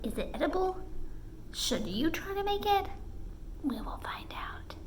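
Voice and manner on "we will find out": hushed and suspenseful; dramatic whisper. Acted. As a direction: tease it in a low whisper.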